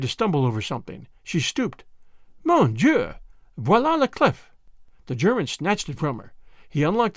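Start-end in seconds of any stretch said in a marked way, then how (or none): 2.4-4.5 high French exclamation; lightly acted girlish surprise; feigned astonishment. A narrator's reading. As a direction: cry out in French at a find. Annette exclaims finding the key.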